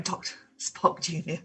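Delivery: ordinary talk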